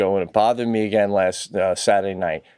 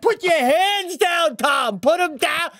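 pitched-up, slow mock plea at the screen; exasperated plea; slower than usual. Yelling at the TV screen at Tom Cruise. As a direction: beg Tom to stop flailing. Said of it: "I'm like".

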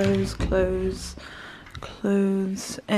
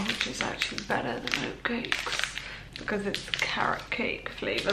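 whisper; 8 dB quieter than usual. Real, not acted. Whispered while eating.

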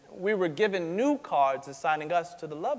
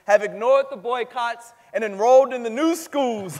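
words lifted higher and louder by a proud laugh; laughing with pride; 7 semitones higher, 8 dB louder than usual. Acted. Speaker proudly telling of the boycott, laughing. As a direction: laugh through it, proud of the count.

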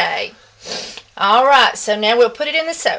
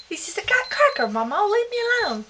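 put-on high, soft little-kid voice; voicing the baby; 9 semitones higher, 8 dB quieter, faster than usual. Grandma voicing baby Henry to mama, high soft baby voice. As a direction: put words in the baby's mouth, high and small.

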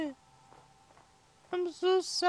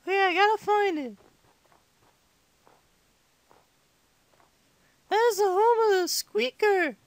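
put-on little kid gamer voice; five-year-old impersonation. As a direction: chat to viewers like a squeaky young child. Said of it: "I talk like a five-year-old".

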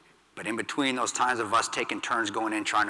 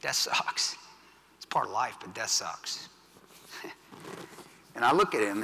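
normal talking voice

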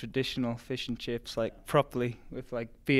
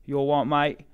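loud, higher mock-London accent; 4 semitones higher, 8 dB louder than usual. Newcastle runner imitating how Londoners talk, mock London accent. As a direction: put on a mock Cockney, loud and pitched up. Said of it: "in London, they'd say".

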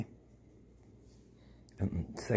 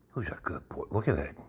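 soft murmur; 2 semitones lower, 7 dB quieter, faster than usual. Real, not acted. Praising dog softly.

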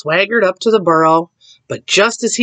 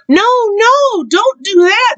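high, louder storybook plea; alarmed warning; 4 dB louder, slower than usual. A narrator's reading. All the animals warning Mama Frog, alarmed cry. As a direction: cry out high to stop her.

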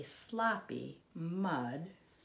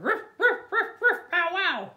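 high loud yappy ruffs; playful dog yaps; loud barking; 12 semitones higher, 9 dB louder than usual. Acted. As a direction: bark like a small dog. Dog barks magic word.

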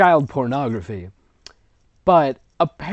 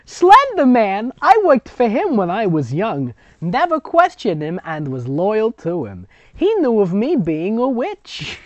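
high, loud hammed-up old woman; grandmother storyteller; 8 semitones higher, 6 dB louder than usual. Grandmother telling the girls her Slender Man story, hammed-up high. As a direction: ham up the grandma boasting of her past. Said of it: "That's the grandmother".

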